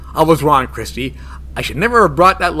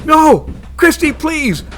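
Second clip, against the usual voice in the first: panicked plea; 10 semitones higher than usual. Acted. Bruno begging Christy as she bends him the wrong way, in high panic. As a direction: beg her to stop in pain.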